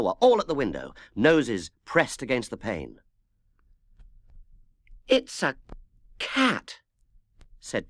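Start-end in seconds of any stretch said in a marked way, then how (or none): 5.1-6.8 high surprised "It's a cat"; amazed discovery. A narrator's reading. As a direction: rise up in astonishment. Peter spots singing cat.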